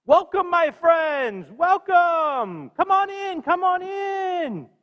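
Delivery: booming, high, slow welcome to guests; hearty welcome. Acted. Abram calling strangers into his tent, booming high welcome. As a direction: throw the tent open, calling them in. Abram greeting visitors in story.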